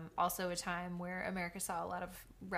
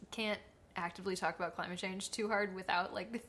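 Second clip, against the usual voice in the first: usual speaking voice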